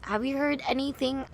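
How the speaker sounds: ordinary talk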